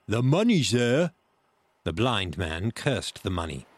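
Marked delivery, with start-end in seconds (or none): 0.1-1.1 raised, loud rough-sailor reply; pirate calling down. A narrator's reading. Man at window answering the blind beggar, loud call.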